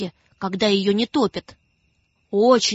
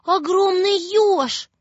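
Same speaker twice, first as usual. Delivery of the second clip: put-on frightened girl; 7 semitones higher than usual. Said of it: "думает девочка Маша".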